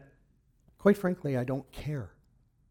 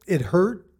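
ordinary talk

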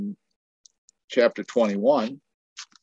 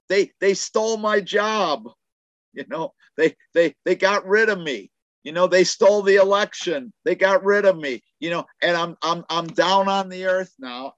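devil's complaint; 8 semitones higher than usual. Acted. Preacher voicing the devil's complaint.

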